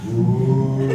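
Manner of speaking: drawn-out ghostly ooh moan; spooky moan. Retold or imitated. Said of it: "And then we heard".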